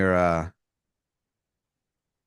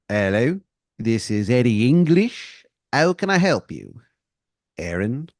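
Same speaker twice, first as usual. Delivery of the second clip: a lightly put-on bad English accent, drawn out; terrible English accent. GM as Eddie English answering the phone, in a bad English accent. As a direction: GM voicing a shady caller in a hammy English accent. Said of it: "He's got a really terrible English accent".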